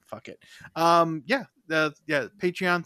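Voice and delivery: usual speaking voice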